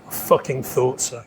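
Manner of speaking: low, quiet deadpan put-down; unamused. Retold or imitated. Actor ending a handshake contest, unamused. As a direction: imitate humourless contempt, dropped low and soft. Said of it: "no hint of amusement".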